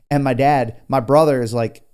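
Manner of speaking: ordinary talk, nothing marked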